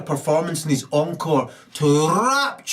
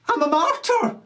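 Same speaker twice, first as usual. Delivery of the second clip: shrill martyr woman; 11 semitones higher, faster than usual. Acted. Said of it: "looks up and says".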